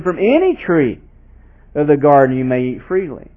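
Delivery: "any" leaned on, stressing freedom. Shown as a demonstration. Pastor stressing freedom to eat from "any" tree. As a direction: give "any" weight, generous. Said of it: "the emphasis there is on the grace".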